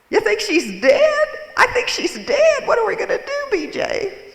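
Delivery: very high, loud, giggly squeak; Minnie Mouse voice. Retold or imitated. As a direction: panicky cartoon-mouse imitation, giggling. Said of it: "in her Minnie Mouse voice".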